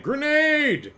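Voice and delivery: high shouted "Grenade!" again; grenade warning yell. Retold or imitated. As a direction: bark it like a game clip. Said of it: "Every time someone throws a grenade".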